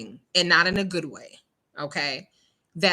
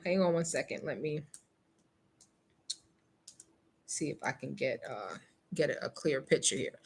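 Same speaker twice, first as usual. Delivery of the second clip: much softer, low mumble to self; 1 muttered aside; 6 semitones lower, 11 dB quieter than usual. Real, not acted. To herself while snapping the clipping, soft low muttering. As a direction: mutter while fiddling with the camera. Busy taking a picture.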